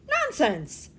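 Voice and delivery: loud, high scoffing shout. A narrator's reading. The crazy prince scoffing at Mister Wonka, shouting. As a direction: bark it out as a spoiled prince. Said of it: "shouted the prince".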